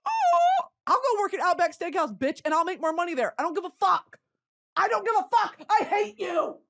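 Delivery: high, cocky rant at the boss; defiant rant. Retold or imitated. Answering the manager's firing threat, high defiant sass. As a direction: sass the manager like you don't care. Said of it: "And I'm like".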